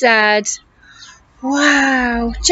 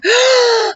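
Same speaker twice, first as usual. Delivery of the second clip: gasp; 6 semitones higher than usual. Acted. Reader gasps.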